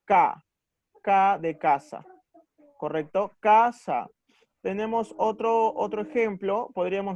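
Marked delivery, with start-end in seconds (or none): 0.0-0.4 "K" said as a loud, single "ca"; stressed syllable CA. Shown as a demonstration. Teacher isolating the accented syllable, a loud stressed "K". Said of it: "la sílaba más fuerte es". 3.4-4.1 "Casa" with a loud first syllable "Ca"; stress on CA. Shown as a demonstration. Teacher demonstrating the accent, loud stress on CA in "Casa". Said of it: "la sílaba más fuerte es".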